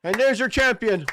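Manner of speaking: high, loud triumphant call; calling the winner. Real, not acted. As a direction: announce the champion like a commentator. Calling the winning putt.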